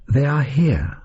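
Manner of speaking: a faint cry, lightly voiced; thin voice. A narrator's reading. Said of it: "a thin sound escaped".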